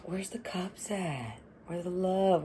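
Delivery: breathy whispered question, almost no voice. Real, not acted. Reader whispering to herself over the cards. Whispered aside during tarot reading.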